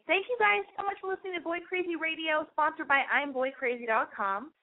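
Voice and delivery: high, bright announcer outro; hammed-up host voice signing off; radio sign-off. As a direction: sell the show like a DJ.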